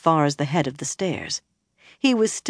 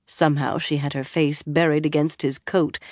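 ordinary delivery, nothing marked

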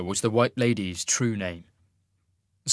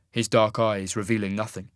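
ordinary delivery

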